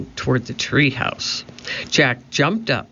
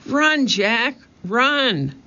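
high, desperate-sounding call; crying out; 10 semitones higher, much slower than usual. A narrator's reading. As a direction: cry out a high, urgent warning. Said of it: "cried Annie".

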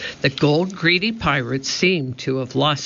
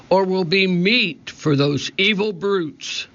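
pirate captain; 6 semitones higher than usual. A narrator's reading. Captain warns of sharks.

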